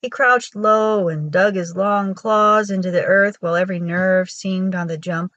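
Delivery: ordinary delivery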